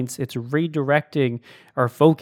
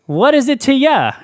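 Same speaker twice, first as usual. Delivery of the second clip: much higher and louder, punchy gangster quip; Christopher Walken impression; 10 semitones higher, 7 dB louder than usual. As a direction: toss it off like a tough guy from the movies. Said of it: "That's the Christopher Walken impression".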